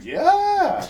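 squealed high yeah; high excited yeah. Retold or imitated. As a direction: pitch way up, loud and bright. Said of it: "I said".

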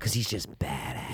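soft unvoiced whisper. Real, not acted. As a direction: hush it like a secret. Whispered aside.

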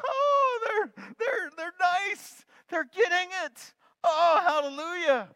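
very high, loud mock-relieved squeal; excited parent voice. Parent imitating their own relief, high excited squeal.

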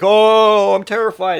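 high, loud drawn-out "Oh"; hammed-up frightened wail; mock terror. Showing how the movie didn't scare him, exaggerated fake fear. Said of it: "didn't scare me in the sense".

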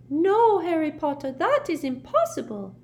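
a little elf piping up with his report; house-elf Dobby. A narrator's reading. Dobby eagerly reports Malfoy's movements to Harry, squeaky.

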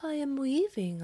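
lightly voiced cat posing as the niece; cat pretending. A narrator's reading.

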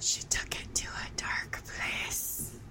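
hushed spooky whisper. Acted. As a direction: whisper it like a secret. Joking aside about dark place.

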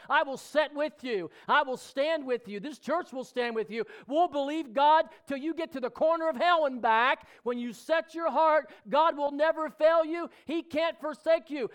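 loud, high, ringing preacher's climax; preaching at full force. Acted. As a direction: drive it home to the congregation.

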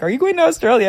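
high, loud mock mom voice; imitating mom. Mom asking her daughter about Australia, imitated high. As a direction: play her surprised mom. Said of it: "My mom was like".

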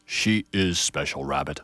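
low, slow elder tree voice; an old pine gently correcting; booming grandfather tree. Acted. Grandfather Ponderosa corrects Rabbit, low and slow. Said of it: "Grandfather Ponderosa's voice boomed".

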